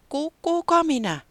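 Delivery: high, stammering, frightened question; trembling voice. A narrator's reading. Frightened Taputi asking the unseen ghost, stammering high. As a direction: stutter it out, scared of the voice. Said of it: "se kysyi värisevällä äänellä".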